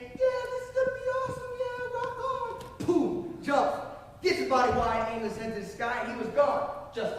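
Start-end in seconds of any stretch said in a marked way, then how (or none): 0.0-2.6 high, stretched-out stoked surfer voice; high goofy "rock on"; hyped-up instructor. Retold or imitated. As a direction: play the pumped jump guy.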